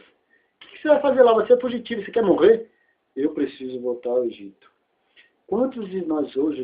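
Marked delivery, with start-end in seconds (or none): 0.0-2.7 higher-pitched wife challenging her husband; esposa incrédula. Acted. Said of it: "O que será que a esposa dele pensou". 3.1-4.7 lower, softer reply to his wife; Moisés respondendo. Acted. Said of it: "E falou pra sua esposa".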